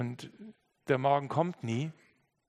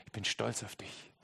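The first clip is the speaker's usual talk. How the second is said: soft, quiet I'm proud of you; tender affirmation; 4 semitones lower, 8 dB quieter than usual. Acted. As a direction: say it gently. Zuspruch leise zitiert. (leise, liebevoll)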